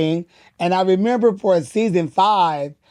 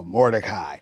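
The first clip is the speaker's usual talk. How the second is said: much lower, softly dropped name; deep voice; 9 semitones lower, 4 dB quieter than usual. Retold or imitated. Performer imitating the director's line in the movie, deep voice. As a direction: drop right down for the deep movie voice. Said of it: "that deep voice is the director's".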